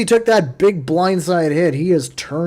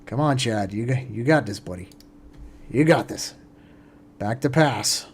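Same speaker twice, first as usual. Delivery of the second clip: ordinary talk, nothing marked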